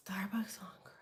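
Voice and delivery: breathless hushed repeat; whispered wonder; awed whisper. Real, not acted. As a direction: gasp then whisper it in awe. Gasps, repeats in awe.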